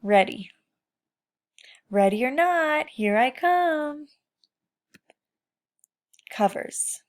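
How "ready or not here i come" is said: hide and seek call. Acted.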